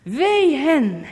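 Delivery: wee luid en nadrukkelijk uitgeroepen; luide waarschuwing. Real, not acted. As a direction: roep het wee uit als een profeet. Prediking over misleiders. (luid uitgeroepen wee)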